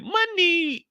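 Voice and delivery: high, squeaky sung-out money; talking credit card. Retold or imitated. The shining platinum card calling out, high and squeaky. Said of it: "That motherfucker said".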